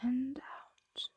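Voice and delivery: calm ASMR sleep whisper; guided sleep whisper. Acted. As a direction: lull them to sleep, breath by breath. Dragon guides listener to sleep.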